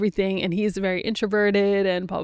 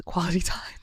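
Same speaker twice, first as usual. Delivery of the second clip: laughing whisper; 4 dB quieter than usual. Real, not acted. Joking reveal about husband.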